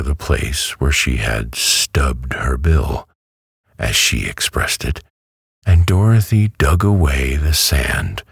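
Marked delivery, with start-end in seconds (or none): none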